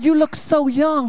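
slow, wide-eyed admiring voice; hammed-up admiring fan; mock admirer. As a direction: gush like an impressed fan.

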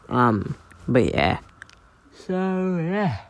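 normal talking voice

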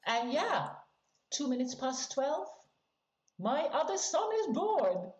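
ordinary talk

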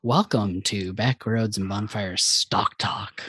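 slow, low show-host intro; hammed-up podcast welcome; mock announcer.

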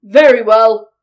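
loud, low troll dismissal; big gruff troll. A narrator's reading. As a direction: boom it out as a grudging troll. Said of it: "said the troll".

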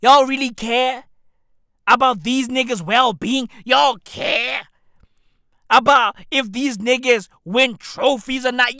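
usual speaking voice

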